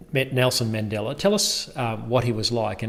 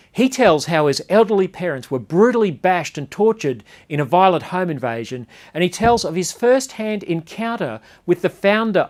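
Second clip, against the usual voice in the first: raised, bright presenter read of the lead-in; news intro read; 6 semitones higher, 5 dB louder than usual. Acted. TV host introducing the interview to viewers, in a presenter read. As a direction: sell the story like a TV reporter opening a segment.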